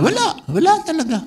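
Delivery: sudden high, mocking scoff. Preacher scoffing at other churches' claims, mocking. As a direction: toss off a scornful no. Mocking answer to own question.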